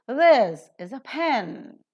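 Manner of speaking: "This is a pen" with THIS and PEN loud and stretched, P aspirated; stress on THIS and aspirated PEN. Shown as a demonstration. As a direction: stretch THIS and PEN, puff the P, keep "is a" light. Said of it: "So it is".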